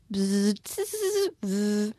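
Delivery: put-on playful buzz for the children; humming buzz. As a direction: hum like a little machine opening. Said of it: "mit einem leichten Summen".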